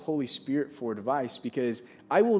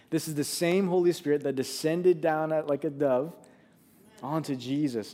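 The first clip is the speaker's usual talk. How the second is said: rising, louder preacher's emphasis; preaching; 2 semitones higher, 4 dB louder than usual. Acted. Pastor stirring the congregation about the Holy Spirit, preaching with force. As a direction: build it like a sermon climax.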